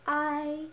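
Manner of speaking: "I" held high and level; first tone. Shown as a demonstration. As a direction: sing out "I" on one high, even pitch. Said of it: "here are the four tones".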